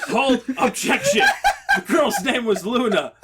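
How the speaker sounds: laughing while talking; laughing through the words. Real, not acted. Laughing at the Karen/Luna mix-up.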